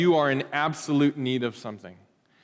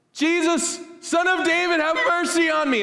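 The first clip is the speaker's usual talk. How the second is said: a worshipper crying out for mercy. Retold or imitated. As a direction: cry out for mercy at full voice. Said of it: "started crying out for Jesus".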